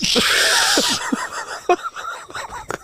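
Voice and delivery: very high voiceless squeal of laughter; high wheezing squeal. Real, not acted. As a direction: shriek with delight. Laughs at own riddle.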